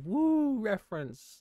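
high, drawn-out mock cheer; mock excitement. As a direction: fake a big excited woo, slowly. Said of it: "you're supposed to go".

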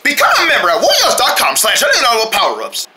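hyped ad announcer. Acted. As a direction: sell the shoes with hype. Fake sneaker commercial read.

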